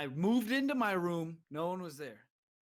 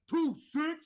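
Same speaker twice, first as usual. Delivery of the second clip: high, loud imitated club call; 9 semitones higher, 6 dB louder than usual. Somebody at the club calling to everyone, hollered. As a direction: holler it to get the club responding.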